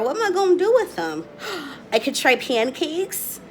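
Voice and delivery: ordinary talk, nothing marked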